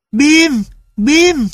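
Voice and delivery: put-on high, excited child's shout of a name; a boy thrilled to see his dog; boy crying out. A narrator's reading. Tulip sees Bim at the door, excited high cry. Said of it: "Tulip kêu lên".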